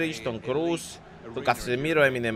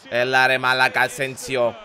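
usual speaking voice

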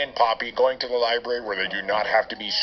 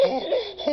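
breathless squeal; 4 dB quieter than usual. Acted. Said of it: "I gotta breathe".

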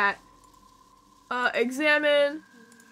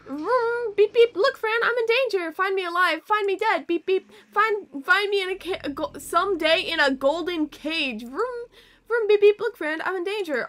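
high, silly toy-car chant; playful vroom-beep voice; 6 semitones higher than usual. Pretend. Player making car noises and reciting danger lines, high playful voice.

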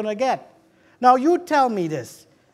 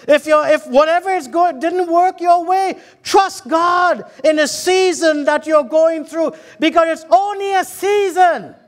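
raised, emphatic preacher's delivery; preaching; 5 semitones higher, 4 dB louder than usual. Acted. Preacher telling the congregation of gratitude to God, preaching. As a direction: preach it out with conviction.

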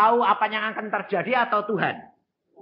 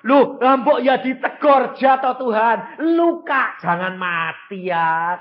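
higher, louder mock plea, slowed at the start; pleading believer; 6 semitones higher, 6 dB louder, slower than usual. Preacher mimics a congregant begging God to spare the king. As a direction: whine at God like a worried believer. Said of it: "Mungkin saudara berkata apa?".

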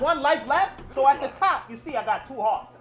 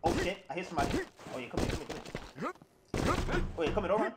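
hushed stalking; 5 semitones lower, 9 dB quieter, much faster than usual. Real, not acted. Sneaking up on enemy.

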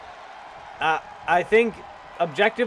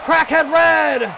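drawn-out hype shout; 8 semitones higher, 6 dB louder, much slower than usual. Real, not acted. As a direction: stretch "Crackhead Red!" slowly, high and loud, like calling a finisher. Commentator names the finisher.

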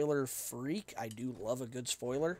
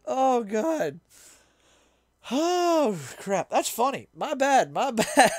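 high, loud, winded from real laughter; real laughing fit, words squeezed out loudly; laughing embarrassment; 9 semitones higher, 9 dB louder, slower than usual. Real, not acted. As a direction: groan and crow through the laughs at your own blunder. Still laughing at own mistake.

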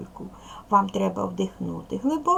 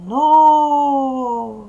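long, stretched call to a horse, louder and higher; протяжне «Но, но»; drawn-out giddy-up; 5 semitones higher, 4 dB louder than usual. Shown as a demonstration. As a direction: draw out each "Но" like urging a pony on. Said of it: "протяжно".

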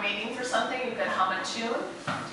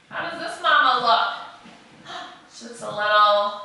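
usual speaking voice

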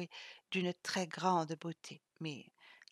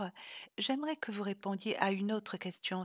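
ordinary delivery

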